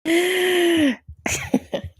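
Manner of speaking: long wheezing exhale; drawn-out, high wheezy sigh; wheezy breath. Shown as a demonstration. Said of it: "can you hear that?".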